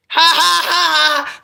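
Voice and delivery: loud high mock laughter, ha ha ha; imitated hard laugh. Ex-husband laughing hard at her joke, loud mock laughter. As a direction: cackle like the ex-husband did. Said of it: "he laughed, like, really hard".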